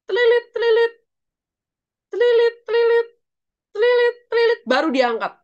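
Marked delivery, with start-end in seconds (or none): 0.0-0.9 high, loud trilling ringtone; trilling up high like a telephone; imitated phone ring. Shown as a demonstration. Counts out the phone rings. 2.1-4.6 high, loud trilling ringtone; trilling up high like a telephone; imitated phone ring. Shown as a demonstration. Counts out the phone rings.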